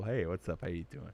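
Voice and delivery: normal talking voice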